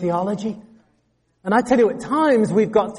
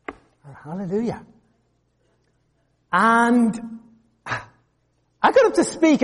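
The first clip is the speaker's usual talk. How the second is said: ordinary delivery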